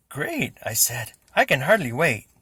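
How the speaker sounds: loud, high eager reply; can't contain the excitement; excited. A narrator's reading. Boy answering Reggie loudly with eager excitement.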